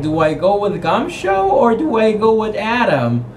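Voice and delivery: high, torn whine; conflicted. Acted. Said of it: "she's conflicted because she's like".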